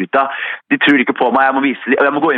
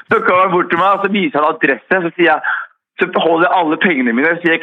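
usual speaking voice

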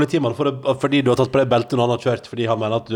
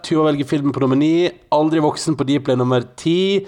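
game show host; 4 semitones higher than usual. Acted. Continues wheel list.

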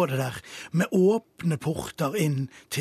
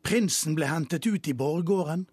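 usual speaking voice

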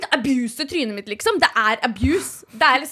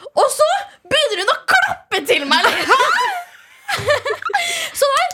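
higher, louder exclaim; shocked, rising shout; outraged punchline; 12 semitones higher, 4 dB louder than usual. Real, not acted. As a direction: blurt it out in disbelief.

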